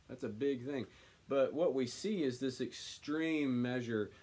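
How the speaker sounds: usual speaking voice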